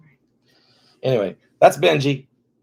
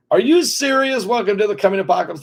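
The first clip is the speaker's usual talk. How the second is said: high, fast, hammed-up doom cry; mock apocalyptic announcement; mock outrage; 9 semitones higher, 5 dB louder than usual. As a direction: play up the disbelief, then announce doom. Mock dramatic outburst.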